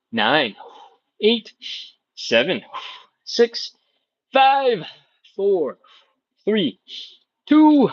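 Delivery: steady counted-out seconds; coach's paced countdown; slow countdown. Shown as a demonstration. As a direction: count down the hold calmly. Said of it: "hang out here for thirty seconds".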